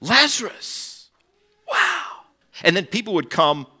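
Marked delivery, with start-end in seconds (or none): 0.0-2.2 much higher, drawn-out Lazarus; awestruck wow. Retold or imitated. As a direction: slowly marvel at the name.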